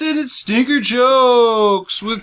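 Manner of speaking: high, slow hammy host intro; announcer. Acted. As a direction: announce it grandly.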